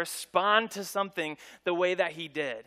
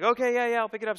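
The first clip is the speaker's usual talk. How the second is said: higher, louder hurried nervous agreeing; nervous placating; 6 semitones higher, 6 dB louder, much faster than usual. Retold or imitated. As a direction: cave in fast to the big angry guy. Neighbor caught by angry dad.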